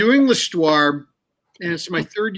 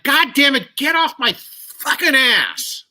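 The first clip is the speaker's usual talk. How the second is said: retold angry pushback, pitched up and stretched at the end; re-enacted pissed outburst; 7 semitones higher than usual. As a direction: act out finally snapping, dragging out the last words. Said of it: "getting more and more pissed".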